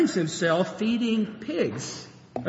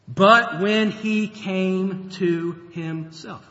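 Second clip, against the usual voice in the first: each word drawn out slowly; slow emphatic reading; much slower than usual. Shown as a demonstration. Preacher lingering over the key verse, slow and weighty. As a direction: read it slowly so it sinks in. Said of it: "a great verse to underline".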